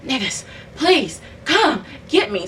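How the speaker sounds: high, slow, strained begging; desperate plea. Retold or imitated. Sick caller begging family for rescue, desperate plea. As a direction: imitate pleading through a struggle to talk. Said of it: "I couldn't even talk".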